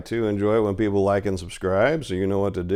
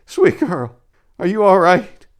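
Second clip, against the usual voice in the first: much higher, louder anxious call; crying out; 10 semitones higher, 8 dB louder, slower than usual. Acted. Remius cries out to Lilia amid the carnage, higher and louder. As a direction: call out to the girl in alarm. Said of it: "he cried".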